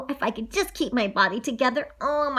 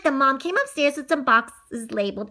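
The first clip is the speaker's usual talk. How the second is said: ordinary delivery, nothing marked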